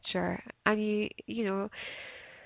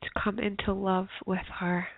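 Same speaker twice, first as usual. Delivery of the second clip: guided meditation. Acted. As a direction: ease them gently into stillness.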